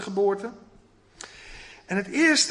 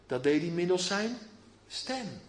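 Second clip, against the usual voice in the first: ordinary talk, nothing marked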